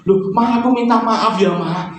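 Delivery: put-on high, pleading little-boy voice; pleading child. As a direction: beg mum's forgiveness like a small child. Child apologizing to mother.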